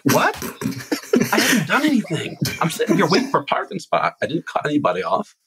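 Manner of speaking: high-pitched, baffled self-defense; incredulous protest. Retold or imitated. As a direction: retell a startled "who, me?" protest, easing down at the end. Retelling his reply to angry driver.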